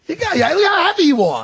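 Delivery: high, loud real outburst; excited exclaiming. Real, not acted. Pranked host to the tricksters, excited exclaiming. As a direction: cry out at being pranked.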